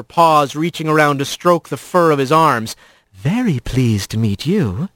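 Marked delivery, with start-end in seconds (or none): 3.1-4.9 low, slow flirtation; flirtatious purr. A narrator's reading. Laris pressing against the new rat, flirting. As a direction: draw it out low and slow, coming on to him.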